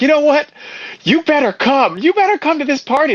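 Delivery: hammed-up demand to come to the party; mock pushy Hank; mock furious Hank. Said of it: "He was furious with me".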